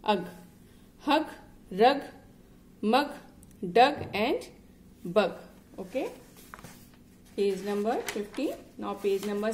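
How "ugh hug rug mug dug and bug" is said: each word alone, louder and emphatic; read-along drill. Shown as a demonstration. Teacher reading a word list with a child, loud and emphatic. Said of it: "just read it with me".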